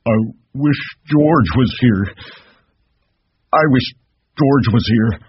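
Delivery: high, loud whine of a simple man; plaintive high whining. A narrator's reading. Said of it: "Lenny whined".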